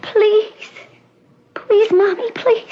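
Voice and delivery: high, pleading little please; begging. Acted. Daughter begging her mother to go away, pleading. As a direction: beg her like a frightened child.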